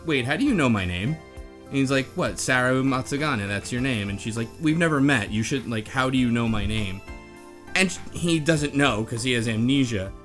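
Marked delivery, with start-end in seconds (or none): none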